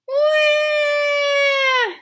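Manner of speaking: high, loud mock baby wail; toddler bawling wail. Toddler bawling at her daddy in a loud wail. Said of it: "Trixie bawled".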